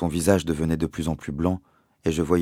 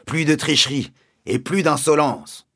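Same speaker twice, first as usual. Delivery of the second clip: much higher, louder, put-on menacing teacher; terrifying voice; 9 semitones higher, 4 dB louder than usual. A narrator's reading. Captain warning the class against cheating, loud and menacing. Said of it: "a dit la voix terrifiante".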